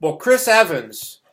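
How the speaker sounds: normal talking voice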